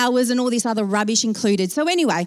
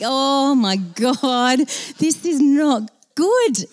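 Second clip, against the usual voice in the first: slow, drawn-out higher wail of dismay; dramatic dread; 3 semitones higher, much slower than usual. Retold or imitated. Passenger hearing the jump plan, slow dramatic dread. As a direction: stretch every word in mock horror.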